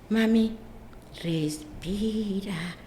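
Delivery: soft, very slow, drawn-out words; gentle soothing. Retold or imitated. Son with Down syndrome calming his mother, soft and slow. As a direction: ease mom down, breath by breath.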